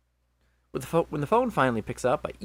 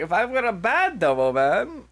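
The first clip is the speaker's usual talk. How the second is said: high, loud Demo Man Scottish accent; loud mock-Scottish game character; 9 semitones higher, 7 dB louder than usual. Shown as a demonstration. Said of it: "Do a Demo Man accent".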